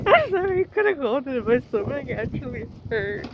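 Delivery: sorry through real laughter; laughing. Real, not acted. Apologizes laughing after failing.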